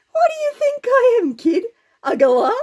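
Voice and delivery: loud, high scornful jeer; Goliath sneering down at the kid; mocking giant. Goliath taunting young Dave, loud high scornful jeer. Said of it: "he laughed his head off, saying".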